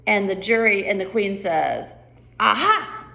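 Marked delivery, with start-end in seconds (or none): 2.4-3.0 accusing aha. Shown as a demonstration. Queen accusing.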